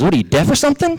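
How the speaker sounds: high-pitched, indignant challenge; upset rabbit. Acted. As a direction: act out a bossy rabbit taking offense. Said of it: "this really upsets Briar Rabbit".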